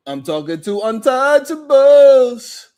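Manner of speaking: loud, drawn-out announcement; mock proclamation. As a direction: boom it out slowly for the audience. Announcing addressee amid mock serenade.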